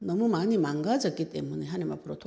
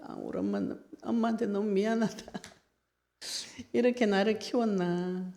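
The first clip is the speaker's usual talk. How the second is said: mild tearful remorse; 3 semitones higher than usual. Retold or imitated. New mother sorry toward her own mother, mildly tearful. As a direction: let a touch of choked-up regret lift the voice.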